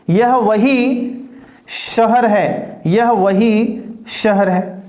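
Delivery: very slow, stretched words; slow word-by-word read; slow dictation. Shown as a demonstration. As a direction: dictate the new example slowly. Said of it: "dictates new example slowly".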